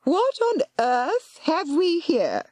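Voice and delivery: put-on high, slow, commanding old woman. A narrator's reading. Aunt commanding the intruders. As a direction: command the room. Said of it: "a commanding voice".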